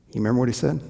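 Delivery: ordinary delivery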